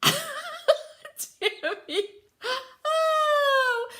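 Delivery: high squealing laugh that spills into words; delighted laugh. Real, not acted. Host laughing at her viewer Tammy's comment.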